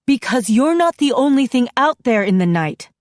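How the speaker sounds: a sudden loud warning cry; exclaiming. A narrator's reading. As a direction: burst out at her daughter. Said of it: "she exclaims".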